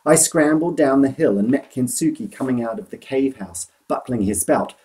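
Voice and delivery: plain reading voice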